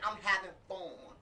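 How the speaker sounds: slow, drawn-out Atlanta "fun", like "fone"; Atlanta accent attempt. Shown as a demonstration. Co-host showing off for the host, attempted Atlanta accent. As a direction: stretch it in a mock Atlanta accent. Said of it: "I'm gonna get the Atlanta accent".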